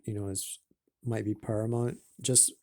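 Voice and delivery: ordinary talk, nothing marked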